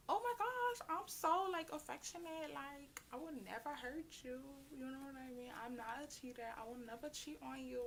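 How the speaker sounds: high, soft, sugary promise; mock sweet. Mocking her own sweet promises to the boy, soft and high. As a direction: coo it like a lovestruck girl.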